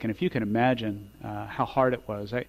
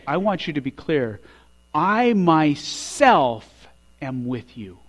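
very slow and louder, each word of I myself am with you weighted; emphatic; 4 semitones higher, 6 dB louder, slower than usual. Acted. Jesus promising his disciples, voiced slow and emphatic. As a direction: lean hard on every word of I myself am with you. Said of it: "he chose to be emphatic".

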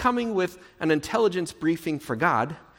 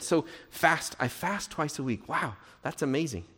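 ordinary talk, nothing marked

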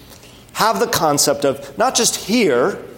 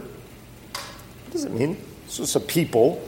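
hushed aside; 9 dB quieter than usual. Real, not acted.

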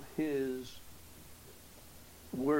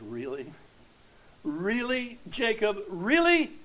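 disbelief building until the last one is louder and higher; each really more incredulous than the one before; incredulous challenge; 5 semitones higher, 6 dB louder, slower than usual. Acted. Preacher confronting Jacob over his complaint, mounting disbelief.

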